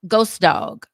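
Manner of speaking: ordinary delivery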